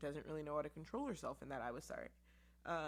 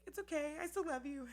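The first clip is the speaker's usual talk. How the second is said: higher, sweet child voice, lightly voiced; son's comforting voice; 11 semitones higher, 4 dB louder than usual. Seven-year-old son comforting his mother, higher. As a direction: reassure her like a small boy. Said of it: "And he was like".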